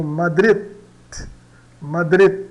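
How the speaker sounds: "Madrid" stopping on a barely released D; almost silent final D. Shown as a demonstration. Teacher showing D at word end, almost silent. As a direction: hit the last syllable of "Madrid" and hold back the D. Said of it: "ela é quase muda no final".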